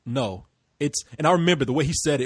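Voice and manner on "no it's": low, ominous retold reply. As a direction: say it low, like a warning. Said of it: "It was so ominous".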